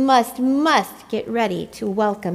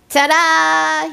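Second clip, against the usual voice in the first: loud, higher showy ta-da; chirpy ta-da; 8 semitones higher, 8 dB louder than usual. A narrator's reading. Tacky showing off his outfit, chirpy ta-da. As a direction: present himself with a flourish. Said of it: "he chirped".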